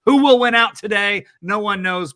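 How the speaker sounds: loud, high boxing announcer hype; boxing ring announcer. Pretend. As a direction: build it up like a ringside announcer. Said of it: "I wanna do a little bit of a boxing announcer voice".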